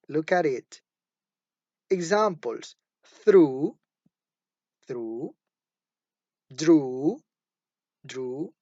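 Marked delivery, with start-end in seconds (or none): 3.2-5.3 "Through" with a fricative r after th; teacher's example "Through", r fricative after th. Shown as a demonstration. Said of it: "Er becomes fricative when it occurs after t". 6.5-8.5 "drew" with a fricative r after d; teacher's example "drew", r fricative after d. Shown as a demonstration. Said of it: "Er becomes fricative when it occurs after t".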